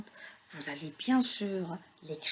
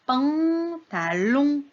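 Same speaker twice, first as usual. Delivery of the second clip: "pantalon" very slow and higher; sounding out; 4 semitones higher, 4 dB louder, much slower than usual. Shown as a demonstration. Teacher warns children to listen to the vowels, sounding it out. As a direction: stretch "pan" and "lon" in "pantalon".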